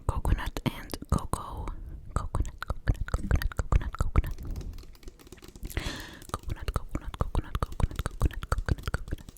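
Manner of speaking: whispering coconut over and over; ASMR trigger repetition; repeated trigger word. Shown as a demonstration. (تكرار كلمة كوكونات همسًا)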